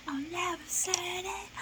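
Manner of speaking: "I never said it" whispered high through tears; whispered, crying. Real, not acted. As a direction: breathe "I never said it" almost silently. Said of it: "This was really making me cry".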